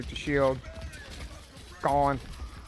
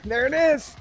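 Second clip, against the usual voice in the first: high, loud rallying yell; excited shout; 12 semitones higher, 6 dB louder than usual. Real, not acted. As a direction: hype the team up, full voice. Calling target in team fight.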